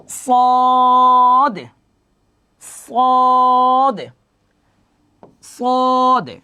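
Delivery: সদ and বদ held long and high, drawn-out vowels; sustained recitation. Shown as a demonstration. Teacher drilling the letter sound for the class. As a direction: chant each সদ slowly for students to copy. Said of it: "খেয়াল করেন".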